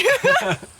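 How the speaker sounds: loud, high embarrassed laugh; sheepish laugh after the interviewer teases her; real burst of laughter. Real, not acted. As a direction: laugh out loud at being caught out. Caught out by interviewer's joke.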